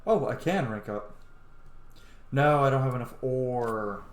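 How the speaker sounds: usual speaking voice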